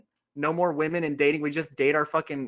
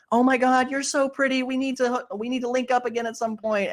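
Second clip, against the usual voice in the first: put-on gushing girl; 8 semitones higher than usual. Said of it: "girls will go to each other's accounts and be like".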